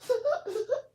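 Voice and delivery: sudden high burst of laughter; real amused laugh. Real, not acted. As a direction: let a squeal of laughter out. Reacting to comment.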